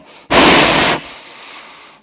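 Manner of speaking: loud heavy rasping breath into the phone; Darth Vader breath. Retold or imitated. Imitating a snoring caller on the phone, loud Vader breath. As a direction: breathe like a masked villain through a respirator. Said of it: "sounded a little bit like Darth Vader on the phone".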